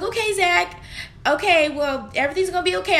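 high, strained reassurance, retold; trying not to cry. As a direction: act out holding back tears while comforting him. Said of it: "I was trying not to cry".

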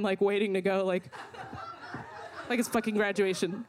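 normal talking voice

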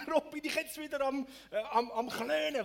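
high, loud mock lament; exasperated outcry. Interpreter voicing the psalmist's mourning, loud and exasperated. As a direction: wail the complaint dramatically.

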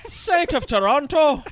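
high, loud, slow Canadian exclamation; mock Canadian accent. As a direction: swear it in hammed-up Canadian. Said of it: "We're so Canadian".

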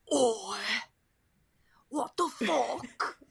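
slow, lightly growled British tough guy; a lightly voiced Statham "Oi"; bad Jason Statham. Joking about the film, a bad Jason Statham impression. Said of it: "That's my really bad Jason Statham".

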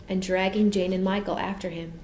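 usual speaking voice

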